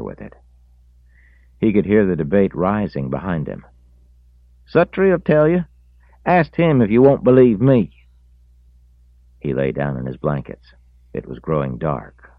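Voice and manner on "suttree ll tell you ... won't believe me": very high, loud insisting; loud arguing. A narrator's reading. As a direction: insist loudly. Reese arguing.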